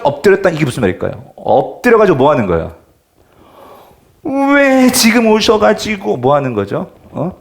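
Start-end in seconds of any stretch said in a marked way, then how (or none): 4.2-6.2 slow, drawn-out, higher complaint; mock lament. Preacher voicing Mary's complaint to Jesus, slow lament. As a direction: stretch the words out like a grieving reproach. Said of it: "신세한탄 하는 거지".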